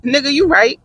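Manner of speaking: suddenly conceding with a touch of mock surprise; agreeing with husband, lightly hammed-up realisation. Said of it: "I said".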